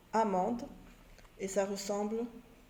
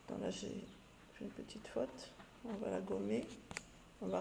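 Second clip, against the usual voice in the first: whispered aside; 14 dB quieter, faster than usual. Real, not acted. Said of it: "aside while correcting a mistake".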